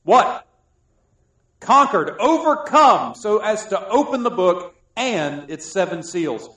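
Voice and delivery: loud proclaiming of scripture; preacher proclaiming the Lion of Judah loudly; preaching. Acted. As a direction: build it like a herald's announcement. Proclaims scripture at full force.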